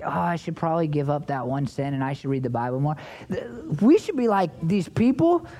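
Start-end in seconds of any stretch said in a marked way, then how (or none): 0.0-3.0 low-pitched, half-hearted resolve. Acted. Preacher mimicking Christians who only wish to improve, half-hearted. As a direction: mimic a timid believer's weak intention, pitched well down. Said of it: "instead of like".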